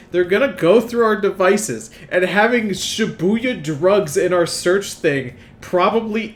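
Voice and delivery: loud, high, exasperated rant; exasperated scolding. Acted. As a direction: chew him out, fed up. Scolding Ryuji for his search.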